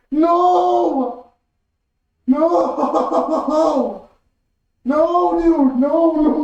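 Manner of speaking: loud, high string of no's fading down; a long wail of denial; real despair winding down; anguished no's. Real, not acted. Reacting to character death.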